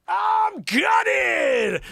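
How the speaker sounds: very high, loud theatrical wail; mock-anguished shout. Host rereading [name]'s headline quote, mock-anguished shout. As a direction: yell it out as if devastated.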